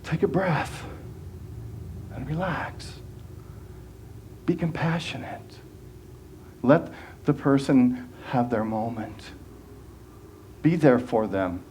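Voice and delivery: soft, hushed reminder to relax; quiet soothing inner voice; calming self-talk. Shown as a demonstration. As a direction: talk yourself down gently, half whispered. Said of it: "Just to say".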